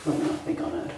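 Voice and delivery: hushed whisper, stress on let. Acted. Second take, voice drops away.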